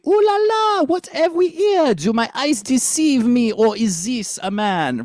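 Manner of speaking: high French accent, "here" with no H. Shown as a demonstration. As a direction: swoop high through "Ooh la la" in a French accent. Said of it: "So it'd be".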